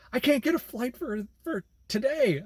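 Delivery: high, fretful imitation of the passenger; panicked passenger. Passenger, no flight, voiced.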